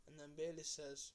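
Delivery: ordinary talk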